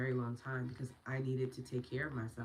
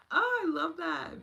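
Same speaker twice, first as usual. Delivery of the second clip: high, louder and slower, savouring each word; gushing delight; 9 dB louder, slower than usual. Real, not acted. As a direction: stretch the oh out with joy. Repeats, even more delighted.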